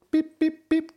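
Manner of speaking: high, mock electronic beeping; tracker beeps. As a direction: chirp it like a tracking receiver. Imitating the tracker signal beeping.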